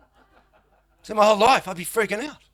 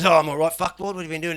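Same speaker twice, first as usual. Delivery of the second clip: blokey mate; 4 semitones higher, 8 dB louder than usual. Retold or imitated. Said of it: "he goes".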